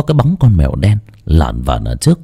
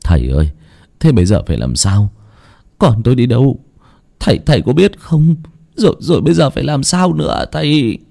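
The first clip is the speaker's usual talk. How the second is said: worried man pleading for guidance, pitch climbing; flustered; 5 semitones higher than usual. A narrator's reading. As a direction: stammer anxiously, rising higher as you go. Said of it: "giọng ông bối rối".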